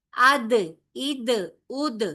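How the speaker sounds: "اد" with the dal bouncing back hard; dal qalqala. Shown as a demonstration. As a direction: punch and bounce the final dal in "اد". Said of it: "حروف کلکلا کی صفت".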